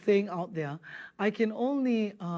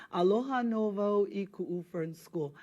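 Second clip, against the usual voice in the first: slow repeat for students. Shown as a demonstration.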